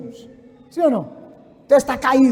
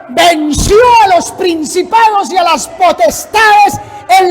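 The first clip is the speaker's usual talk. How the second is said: loud, raised, exultant sermon shout; full-force preaching; 4 semitones higher, 8 dB louder than usual. Real, not acted. Preacher proclaiming Christ's victory on the cross, fiery shouting.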